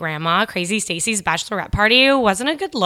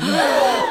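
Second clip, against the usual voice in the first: shocked gasp. Acted. Shocked reaction.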